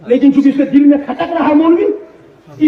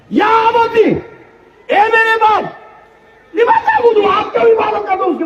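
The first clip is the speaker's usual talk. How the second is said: high, ringing cry to a father; impassioned plea; 11 semitones higher than usual. Acted. Ibrahim crying out to his father over idols, impassioned plea. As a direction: call out to him, begging him to stop.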